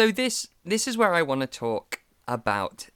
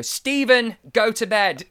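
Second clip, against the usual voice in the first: higher and louder, like a tired parent; stern parental dismissal; 9 semitones higher, 6 dB louder, slower than usual. Retold or imitated. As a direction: send the kid off to bed firmly. Weary actor dismisses child caller.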